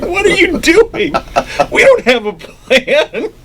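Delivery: very high, drawn-out protest through laughter; laughing mock dismay. Imitating the board's dismay, high laughing wail. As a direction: wail it slowly while laughing. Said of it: "and everyone's like".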